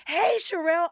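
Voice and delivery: high, loud playful call of her name; yelling across campus. Retold or imitated. B G hollering at Sherelle across campus, high playful yell. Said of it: "B G would yell".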